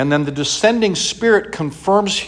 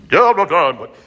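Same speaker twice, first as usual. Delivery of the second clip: mock God voice; 4 semitones higher, 7 dB louder than usual. Mock booming God reply.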